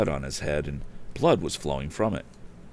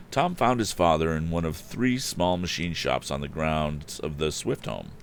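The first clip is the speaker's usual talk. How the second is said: ordinary delivery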